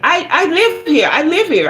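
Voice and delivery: put-on defensive young man. Tenant voiced defensively.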